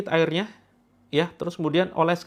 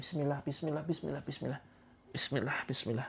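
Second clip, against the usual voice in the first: much softer, murmured on the breath; soft breathy recitation; 12 dB quieter than usual. Shown as a demonstration. Healer demonstrating breathing prayer softly. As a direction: let the words ride the out-breath.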